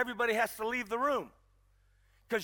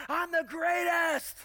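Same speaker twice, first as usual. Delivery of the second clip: higher, slow crowing shout; champion's boast; 5 semitones higher, much slower than usual. Retold or imitated. Champion boasting after winning the belt, slow high crowing. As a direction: crow it out like a winning fighter.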